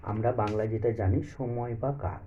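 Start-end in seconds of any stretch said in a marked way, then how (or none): none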